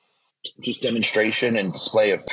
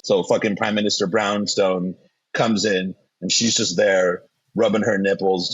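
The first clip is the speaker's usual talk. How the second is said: normal talking voice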